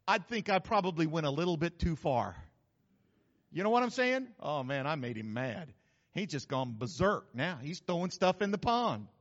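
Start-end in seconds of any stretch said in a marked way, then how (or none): none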